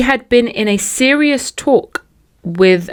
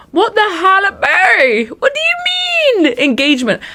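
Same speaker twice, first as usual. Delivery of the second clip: much higher, crying out in disbelief; shocked outcry; 13 semitones higher than usual. Real, not acted. Listener crying out at the engagement news. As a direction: wail at the news.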